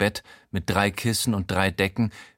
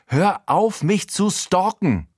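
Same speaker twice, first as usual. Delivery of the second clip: much higher, loud furious yell; shouting back; 10 semitones higher, 3 dB louder, slower than usual. A narrator's reading. Jenny screams at Heinz to stop stalking her, furious. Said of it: "schreit sie zurück".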